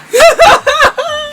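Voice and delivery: high shrieking burst of laughter. Real, not acted. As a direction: let out a squealing cackle. Laugh after sign-off.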